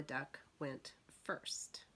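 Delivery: slow suspenseful whisper. A narrator's reading. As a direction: whisper it slowly, building suspense.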